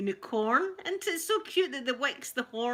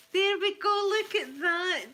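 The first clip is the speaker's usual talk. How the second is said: proud high exclamation; 10 semitones higher, 7 dB louder than usual. Real, not acted. Showing off the scarf.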